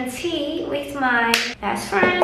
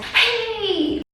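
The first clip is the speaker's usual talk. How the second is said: excited reveal; 10 semitones higher, slower than usual. Real, not acted. Showing results to camera.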